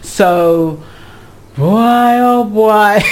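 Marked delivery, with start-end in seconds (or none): 1.6-3.0 long, drawn-out higher exclamation; astonished exclamation. Real, not acted. Host reacting to the cheating dilemma, drawn-out astonishment. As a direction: stretch it out slowly in amazed dismay.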